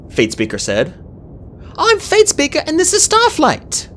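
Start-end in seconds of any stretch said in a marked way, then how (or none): 1.8-3.9 very high, squeaky character voice; high-pitched eager anxiety; nervous young dragon. A narrator's reading. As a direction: introduce yourselves in a nervous squeak. Fatespeaker's high voice, nervous introduction.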